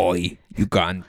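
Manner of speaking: slow mock British Oi; British accent. Friend trying a British accent, slow mock British. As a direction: put on a rough British accent. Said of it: "a British accent".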